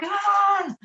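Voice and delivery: high imitated cry of frustration; high-pitched mock toddler yell; frustrated toddler cry. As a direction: mimic a wordless, frustrated little kid. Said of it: "they can't communicate it".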